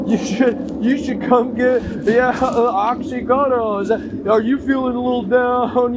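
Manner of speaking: peppy mock pill advert; mock commercial pitch. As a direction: sell it like a pushy TV commercial. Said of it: "they're making commercials".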